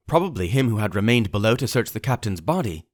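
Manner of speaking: usual speaking voice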